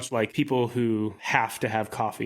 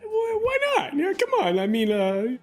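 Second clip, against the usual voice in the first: much higher, indignant pleading; offended; 13 semitones higher, 3 dB louder, faster than usual. Acted. Character offering his drink, indignant squeak at being refused. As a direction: squeak out hurt protest.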